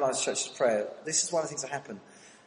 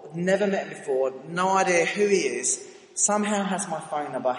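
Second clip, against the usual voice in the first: usual speaking voice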